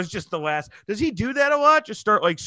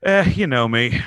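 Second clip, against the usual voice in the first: shrugging, offhand "Eh", lower and louder; 5 semitones lower, 4 dB louder than usual. Retold or imitated. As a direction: toss it off casually with a lazy "Eh".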